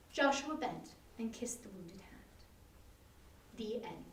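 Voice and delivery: soft, breathy narrator's hush over the last tender moment; whisper. A narrator's reading. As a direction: drop to a whisper for the kiss. Tender ending of a love story.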